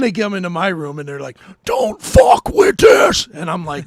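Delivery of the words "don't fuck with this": full-throated furious shout, high and drawn out; angry yell. Retold or imitated. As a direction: yell it slowly at the whole room, reenacting raw anger. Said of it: "yelling at nurses and stuff".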